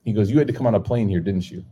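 usual speaking voice

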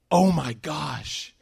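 slow, drawn-out gasp of amazement; amazed. Retold or imitated. Hidden companion bursting out of the closet, slow amazed exclaim. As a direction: stretch it out like he can't believe it.

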